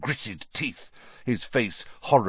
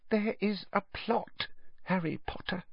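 whispered; 11 semitones higher, 10 dB quieter than usual. Acted. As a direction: lean in and hush it. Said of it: "whispered Dobby".

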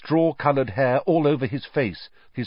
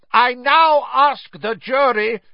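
high, slow, forceful shout; 14 semitones higher, 7 dB louder than usual. A narrator's reading. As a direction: shout it slowly over the screaming. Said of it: "shouted Mister Crouch".

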